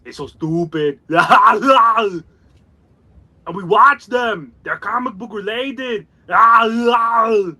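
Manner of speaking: slow, laughing Austrian action-star voice; Arnold Schwarzenegger impression. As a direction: ham up the muscleman accent. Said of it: "Arnie did that introduction".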